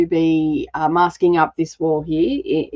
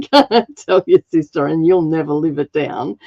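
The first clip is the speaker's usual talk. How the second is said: laughing; 3 semitones lower than usual. Real, not acted. Laughing at the joke.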